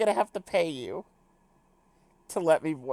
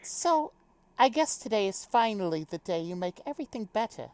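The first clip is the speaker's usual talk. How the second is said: higher-pitched, light British character voice; little British accent; 4 semitones higher than usual. Acted. Game character opens her pep talk, light British accent. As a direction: speak it with a light British lilt. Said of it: "do a little British accent".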